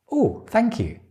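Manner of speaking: high, delighted reply to praise; pleased thanks. Acted. Teacher acting the reply to praise, pleased. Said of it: "it's really good".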